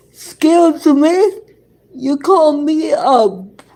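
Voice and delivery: imitated indignant challenge; indignant retort. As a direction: act out taking offence. Acted indignant retort to insult.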